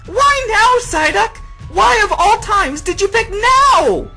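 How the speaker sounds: very high, loud frustrated wail; exasperated screaming. Acted. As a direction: scream in exasperation, stretching the last words. Misty exasperated with Psyduck.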